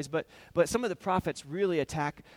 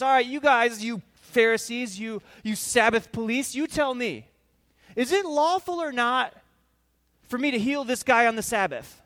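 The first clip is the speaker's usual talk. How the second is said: higher, louder call-out to the crowd; loud challenge; 4 semitones higher, 9 dB louder than usual. Acted. Jesus challenging the Sabbath police, loud and pointed. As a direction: confront them loudly, daring an answer.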